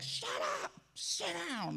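harsh, voiceless mock shout; not nice. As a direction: hiss it high and forceful, imitating an angry pastor. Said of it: "he didn't say it nice".

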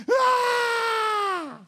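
high, sustained mock scream; imitated child's wail. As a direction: keep bawling like a little kid. Imitating hurt child's cry.

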